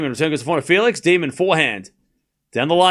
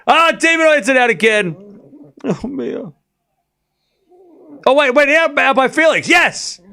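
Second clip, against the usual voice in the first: higher, louder rising excitement; excited; 9 semitones higher, 5 dB louder than usual. Real, not acted. Streamer calling a winning point to chat, excited and high. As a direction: caught up in the rally, voice climbing.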